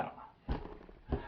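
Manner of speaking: slow voiceless shrug of a whisper; trailing whisper. Shown as a demonstration. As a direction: let it fade into a slow whisper. Repeats phrase, later trailing whisper.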